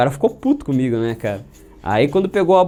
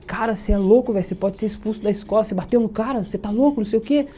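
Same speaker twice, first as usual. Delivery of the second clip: friend alarmed; 7 semitones higher than usual. Retold or imitated. Friend scolding him, retold.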